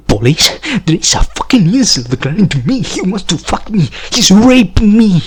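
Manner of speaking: high, rushed mock panic; panicked accuser. Woman calling police on him, high mock panic.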